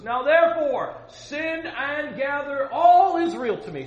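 high, slow shouted command; Elijah yelling back. Acted. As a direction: call out the order across the valley. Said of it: "These two guys yelling at each other".

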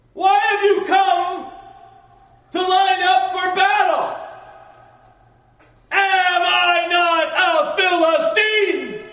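slow, huge roaring giant voice at the top of the lungs; booming Goliath. Acted. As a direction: bellow the challenge like a giant warrior. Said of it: "it's better if I do it this way".

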